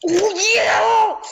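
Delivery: high, loud victory yell; high loud cheer of victory; triumphant shout. Real, not acted. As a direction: scream it after the kill. Winning moment.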